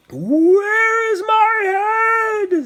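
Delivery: high, very slow wailing ghoul voice; moaning skeleton. Acted. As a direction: wail like a headless skeleton searching. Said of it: "the skeleton said".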